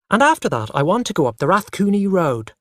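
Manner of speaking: put-on child talking over his parents; loudly. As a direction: say it loud over the interruptions. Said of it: "I said loudly".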